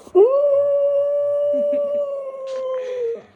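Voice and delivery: loud, high happy laugh; high burst of laughter. Real, not acted. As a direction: laugh out brightly. Laughing at dog.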